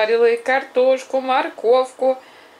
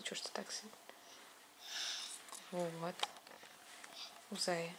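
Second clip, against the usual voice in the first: soft whispered reproach; whispering; 3 semitones lower, 23 dB quieter than usual. Real, not acted. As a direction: hush it, gently scolding. Gentle scolding of child.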